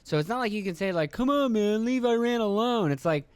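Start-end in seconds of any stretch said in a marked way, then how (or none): 1.1-2.9 high, whiny mock plea; mock protest, pitched well up; pleading defender. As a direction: plead on someone's behalf in a raised voice. Voices a pleading defender of Iran.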